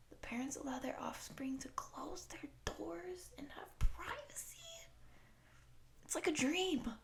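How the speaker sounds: hushed, rising high in wonder; awed disbelief. Acted. Shapeshifter marvelling at human children having privacy, awed. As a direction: marvel at an unheard-of luxury, voice climbing.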